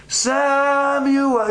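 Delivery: high, long-drawn call. Acted. God calls to sleeping Samuel in the night, long high call. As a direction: stretch the name out on a high, held note. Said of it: "All the time it's".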